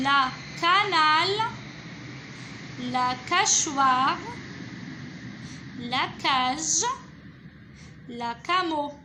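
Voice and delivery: loud, slow syllable reading, "ca" set firmly before each ending; joining syllables. Shown as a demonstration. Teacher testing the last syllable, "cachoire" and the others loudly sounded out. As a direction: project each option from "canal" to "camo", syllables held. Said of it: "Je relis les syllabes".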